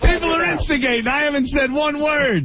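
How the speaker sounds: high-pitched, defensive protest; protesting innocence. Real, not acted. Radio host blaming instigators to the caller, protesting innocence.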